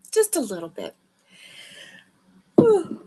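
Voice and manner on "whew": tired loud exhale; loud whew. Real, not acted. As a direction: blow out a big whew. Sleepy host exhaling loudly.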